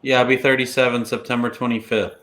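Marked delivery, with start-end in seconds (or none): none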